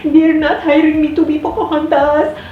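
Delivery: put-on high, loud, tearful whine of a rejected girl; upset girl wailing to a friend about a lost role; imitating a bawling girl. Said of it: "And she came bawling to me".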